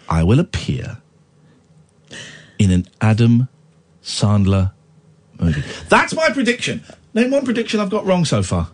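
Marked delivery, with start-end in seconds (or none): 0.1-5.6 mock solemn prophecy. Dramatic prophecy reading.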